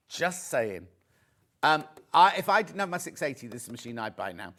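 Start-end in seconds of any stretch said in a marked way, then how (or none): 0.1-0.9 lower, dry knowing repeat; knowing aside. Real, not acted.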